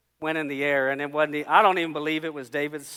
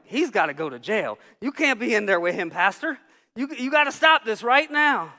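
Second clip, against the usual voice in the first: agitated demand, climbing higher and louder; indignant protest; 6 semitones higher, 5 dB louder than usual. Retold or imitated. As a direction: protest to the pastor, building in force. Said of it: "for a minute I was mad about that".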